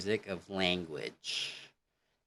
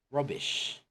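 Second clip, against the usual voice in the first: "rubbish" said higher in a light British accent; 6 semitones higher than usual. Shown as a demonstration. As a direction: give "rubbish" a British lilt. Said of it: "in British".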